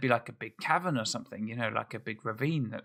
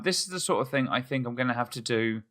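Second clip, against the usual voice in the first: ordinary talk, nothing marked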